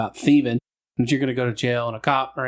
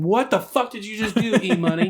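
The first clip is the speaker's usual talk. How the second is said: retold angry boss; 7 semitones higher than usual. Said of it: "My boss said".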